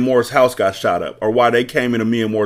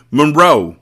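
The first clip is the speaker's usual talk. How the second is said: louder, higher shout of the name; 6 semitones higher, 7 dB louder than usual. Acted. Sister shouts at her brother to break the standoff, shouting. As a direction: yell it to stop the fight. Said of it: "Breeze shouted".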